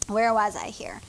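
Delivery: whispered to himself; whispered aside. Real, not acted. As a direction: mutter it while finding the place. Losing his place in the text.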